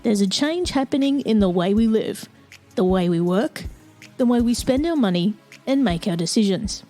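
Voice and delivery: measured announcer read over music; podcast intro read. Acted. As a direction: read it like a polished show opener.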